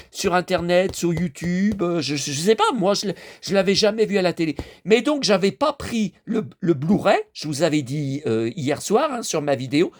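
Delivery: usual speaking voice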